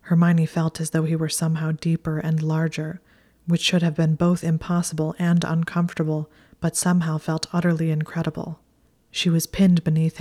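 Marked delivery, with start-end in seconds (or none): none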